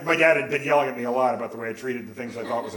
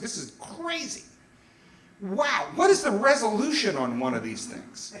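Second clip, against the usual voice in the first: amazed wondering; 7 semitones higher than usual. Retold or imitated. Teenager marveling at a fiber optic camera, amazed. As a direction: wonder aloud in a raised pitch, stretching key words.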